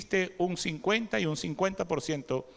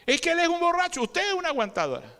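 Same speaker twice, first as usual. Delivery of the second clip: mock whiny complaint; 9 semitones higher than usual. Imitating complainer, high.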